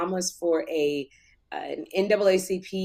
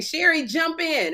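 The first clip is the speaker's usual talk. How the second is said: much higher, slow coaxing; imitating the group egging her on; mimicking others; 10 semitones higher, slower than usual. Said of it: "everybody kept saying".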